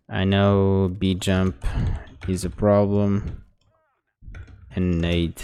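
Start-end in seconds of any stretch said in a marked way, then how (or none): none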